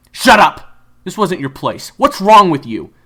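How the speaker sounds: loud, high scolding yell; angry shout. Acted. Kyler scolding June, loud angry shout. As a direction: tell her off hard.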